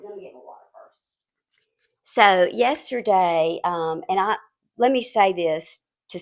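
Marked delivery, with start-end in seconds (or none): 0.0-1.0 whisper. Real, not acted. Aside while reaching for water.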